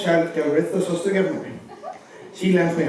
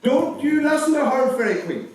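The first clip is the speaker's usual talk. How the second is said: high, insistent outrage; indignant dame; 9 semitones higher than usual. Acted. Dame to Fairy Queen, high and indignant about the witch. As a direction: demand the worst punishment for her.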